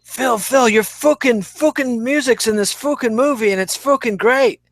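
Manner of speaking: lightly voiced excited Lennon on the phone; [name]. Host voicing Lennon calling Phil, lightly Liverpudlian. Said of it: "adorable Liverpudlian accent".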